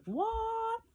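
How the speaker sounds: put-on high, startled "What?" from a child; surprised student. Child staring at teacher without glasses, high startled squeak. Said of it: "and she was like".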